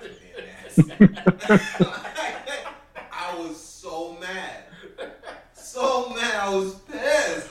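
high, laughing rant about being mad; laughing through anger. Real, not acted. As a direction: laugh it out while fuming. Laughing while recalling anger.

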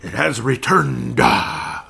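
loud, slow preacher proclamation; evangelist voice. Shown as a demonstration. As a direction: boom it out like a fiery evangelist. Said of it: "I had to say it like an, uh, evangelist".

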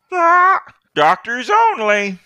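high, slow sniffy bird voice; haughty bird doctor. Acted. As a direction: dismiss him from on high. GM voicing Birdman doctor NPC.

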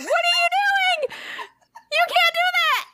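very high, squealed objection; acted shocked protest. Law-abiding child objects to dad, squealing in shock.

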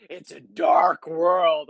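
slow, brooding and ominous; gloomy doom voice. Retold or imitated. Friend mocking the host's gloomy line, slow and ominous. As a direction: drag the words out like a doomsayer. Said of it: "He's like".

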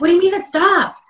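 sudden panic at the news; alarmed. Retold or imitated. Panicked reaction to camera stopping.

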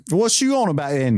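Nick Frost. Acted. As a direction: echo it in a put-on English accent. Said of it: "Yes, N-, Nick Frost".

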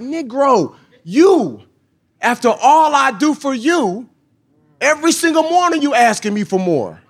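God scolding. Retold or imitated. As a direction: let God rebuke him, laughing through it. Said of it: "And God was like".

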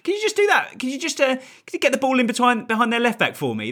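high-pitched sing-song patronising voice; patronising dentist. Retold or imitated. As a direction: talk down to the players like a dentist to a patient. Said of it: "slightly patronising way".

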